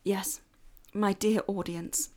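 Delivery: ordinary talk, nothing marked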